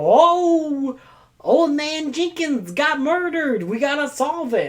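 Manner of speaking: high, slow hammed-up gasp of alarm; mock mystery neighbor. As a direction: play the shocked cozy villager. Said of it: "And we go".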